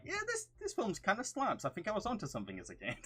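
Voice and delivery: high-pitched, quick burst of approval; mock enthusiastic reaction. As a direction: act out pleasant surprise, voice jumping high. Retold reaction to childhood films.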